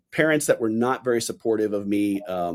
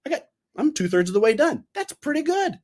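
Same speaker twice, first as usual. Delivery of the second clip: upbeat self-talk; 14 semitones higher than usual. Retold or imitated. His upbeat inner thought.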